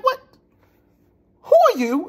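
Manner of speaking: high, loud, exasperated disbelief; acted-out outraged bafflement; incredulous demand. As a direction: demand it with the voice shooting up. Retelling his baffled, angry reply.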